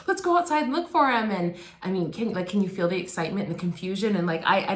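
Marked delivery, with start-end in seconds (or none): 0.1-1.3 high, quick eager grandpa; excited grandpa. Retold or imitated. Grandpa rushing everyone to the door, high and eager. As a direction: hurry the family out with delight. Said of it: "he's like".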